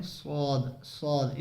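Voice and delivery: स्वाद said softly with a heavy a and no whistle; soft saad. Shown as a demonstration. Teacher to viewers, a quiet heavy स्वाद. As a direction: keep स्वाद soft and full, no hiss. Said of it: "सिट्टी ज़्यादा नहीं मारनी".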